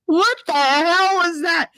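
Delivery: high, drawn-out bafflement; mock disbelief. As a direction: stretch it out, high and incredulous. Retold baffled reaction after sex.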